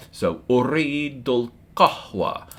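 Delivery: uridul qahwa drawn out slowly; slow Arabic model. Shown as a demonstration. Teacher modelling ordering coffee, slow careful Arabic. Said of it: "I want coffee".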